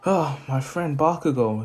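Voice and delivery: plain reading voice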